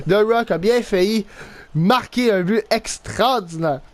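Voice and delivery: usual speaking voice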